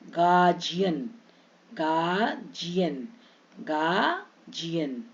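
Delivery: "गार्जियन" slowly as gaa - jiyan; ia as iya. Shown as a demonstration. Teacher modelling i-a words, slow split syllables. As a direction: draw out the first syllable, pause, then jiyan. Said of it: "तो क्या बन जाता है".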